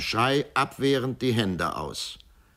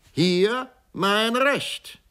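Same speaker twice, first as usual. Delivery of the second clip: triumphant slow shout; 4 dB louder, much slower than usual. Acted. Repeated, higher and louder.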